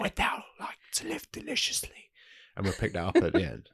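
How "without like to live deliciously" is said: hushed, voiceless imitation of the tempter; whispered film quote. Repeats the film's whispered line.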